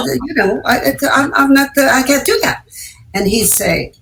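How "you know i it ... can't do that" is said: higher, tripping over the words; flustered protest. Retold or imitated. Young actress protests to director, flustered and high. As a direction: sputter your objection to him.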